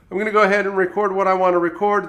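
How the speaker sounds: usual speaking voice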